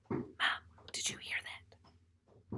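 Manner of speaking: slow, hushed whisper to a sleeping mother; waking mom with a frightened whisper. Acted. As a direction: whisper it urgently but softly. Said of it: "I whisper".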